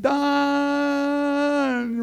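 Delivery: put-on high, child-like call of Don; little girl calling. Little girl calling his name.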